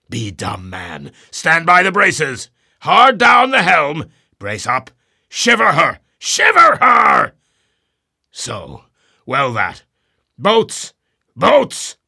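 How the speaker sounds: loud, high shouted commands, peaking on shiver her; shouted orders. A narrator's reading. Ahab ordering the boats down, shouting. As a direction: bellow over the deck.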